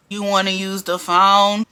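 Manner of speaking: slow, low mimicry of a coworker; mock mellow, deep-voiced question. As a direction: drop your voice deep and drag it, imitating her. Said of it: "Real deep and mellow".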